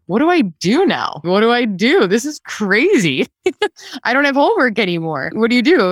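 replaying giddy panic on the drive home. Driving home after quitting, giddy high panic.

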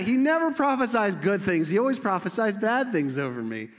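high, loud petulant king; mock whiny complaint. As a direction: gripe like a sulking king. Acts out the king's complaint.

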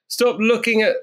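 usual speaking voice

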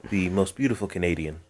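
low, intimate ad read; mock commercial voice-over. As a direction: croon it like a glossy perfume ad. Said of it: "I'm doing a Neve Campbell commercial".

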